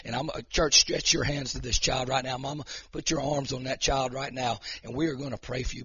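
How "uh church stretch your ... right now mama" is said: ordinary talk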